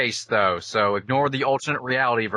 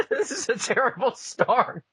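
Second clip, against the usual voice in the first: laughing through words; giggling as you speak; 12 semitones higher than usual. Real, not acted.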